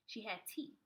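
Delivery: ordinary delivery, nothing marked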